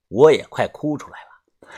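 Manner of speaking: plain reading voice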